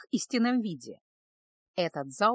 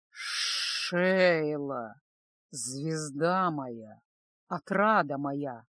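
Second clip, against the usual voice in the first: low, slow, lingering endearments; fond lord; 5 semitones lower, much slower than usual. A narrator's reading. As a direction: savour each endearment in a deep voice. Lord caresses his favourite concubine.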